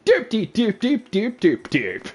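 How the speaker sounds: high, very slow silly voice; sing-song goofy nonsense; goofy cartoon voice. Silly Rob Schneider bit.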